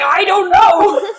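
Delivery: high, loud, slow, laughing I don't know; laughing mock reply. Official clueless on the phone, laughing high and slow. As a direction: squeeze it out through laughter.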